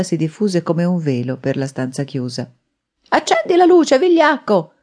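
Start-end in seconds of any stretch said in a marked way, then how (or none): 3.1-4.7 high, loud angry shout; furious yell. A narrator's reading. Enraged gunman demanding light from a coward, furious yell. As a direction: yell the order at full force, pitch way up. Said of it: "urlò".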